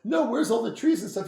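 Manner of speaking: high, loud mock-outraged question; retold incredulous demand. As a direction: demand an answer in comic disbelief. Retold disbelief at treeless landscape.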